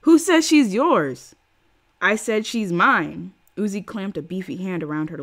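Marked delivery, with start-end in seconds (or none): 0.0-1.3 high, slow, loud hostile voice; angry challenge. Acted. Angry crowd member challenges her. 2.0-3.3 menacing. Acted. Uzi claims the puppy.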